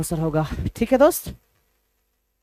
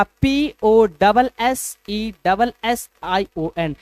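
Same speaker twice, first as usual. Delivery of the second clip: slow spelling out; much slower than usual. Shown as a demonstration. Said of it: "position का spelling क्या होगा".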